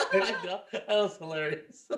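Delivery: laughing. Real, not acted. Laughs at accent joke.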